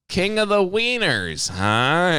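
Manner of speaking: high, slow mock greeting; teasing catchphrase. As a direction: draw it out to jog his memory. Throws customer's catchphrase back at him.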